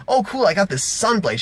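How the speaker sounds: mildly giddy mock voice; delighted player. Imitating Sonya delighted with the sword.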